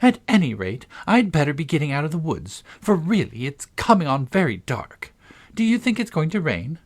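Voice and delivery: put-on high, bright-hearted girl; cheerful chatter covering tears; determinedly cheerful. A narrator's reading. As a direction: put on cheer with a lifted pitch. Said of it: "went on as cheerfully as she could".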